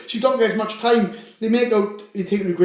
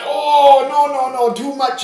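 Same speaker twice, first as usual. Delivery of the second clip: haggling; 6 semitones higher, slower than usual. Retold or imitated. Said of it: "And he'd be like".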